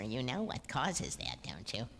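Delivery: low, hushed nosy question; imitated knowing aside. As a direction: drop it low and soft, like a discreet dig. Imitates people's nosy question to sister.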